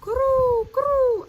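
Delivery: high, loud rolling croo; dove coo. Acted. Reading doves' coo to children, high loud. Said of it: "The doves cooed".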